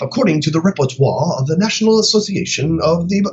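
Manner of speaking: stuffy British report-reader parody; mock British accent. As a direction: try to sound intelligent in a fake British accent. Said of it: "they put on a British accent".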